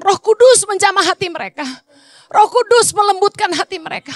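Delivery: high, loud intercession at full force; fervent prayer preaching. Acted. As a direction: pour it out, crying to God.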